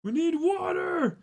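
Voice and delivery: very high, slow yell; frantic cry. Acted. Guard calling for water. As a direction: cry out desperately.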